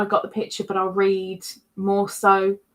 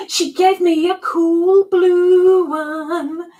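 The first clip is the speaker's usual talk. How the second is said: delighted gasp; 7 semitones higher, 8 dB louder, much slower than usual. Real, not acted. Delighted by a gift.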